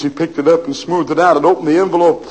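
ordinary talk, nothing marked

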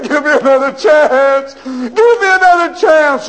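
high, loud begging cry; desperate wailing. Acted. Prisoner crying out down the hallway for another chance. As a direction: plead with all he has left. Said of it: "shook the bars and cried".

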